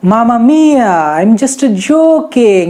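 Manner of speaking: slow, hammed-up Italian accent; Chunky Pandey's Italian. Teacher mimicking a film role for students, mock Italian. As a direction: stretch it like a comic Italian. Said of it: "Chunky Pandey का वो role".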